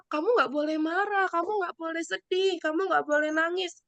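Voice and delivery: mock a helper who forbids feelings; dismissive scolding. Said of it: "Jangan katakan". (menirukan nada melarang dengan suara lebih tinggi)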